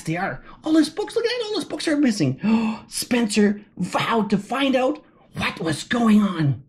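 ordinary delivery, nothing marked

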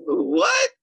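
very high shocked what; high astonished squeal; stunned what. Retold or imitated. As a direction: squeak it out in disbelief. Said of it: "I'm like".

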